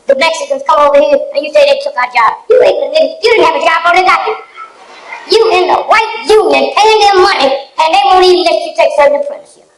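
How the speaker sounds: high, loud sermon-style scolding; fiery preaching. Acted. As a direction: preach it at them, building heat. Preaching at the audience.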